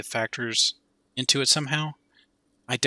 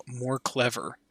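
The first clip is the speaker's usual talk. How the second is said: softly muttered "more clever" with the voice dropping away; muttered to himself; 6 dB quieter, slower than usual. A narrator's reading. Detective muttering to himself, quietly. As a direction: say it under your breath to yourself. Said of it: "the detective whispers to himself".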